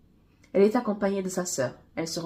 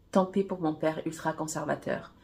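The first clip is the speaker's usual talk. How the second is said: ordinary delivery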